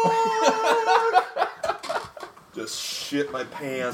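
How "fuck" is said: very high, loud drawn-out yell; panicked scream. Retold or imitated. Comic suddenly called on stage, panicked scream.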